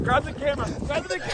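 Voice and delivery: urgent excitement. Real, not acted. Urgent request after the catch.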